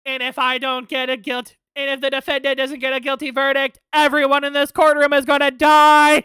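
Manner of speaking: mock threat. Villain threatens everyone in courtroom, mock shouted threat. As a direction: build the threat to a booming shout.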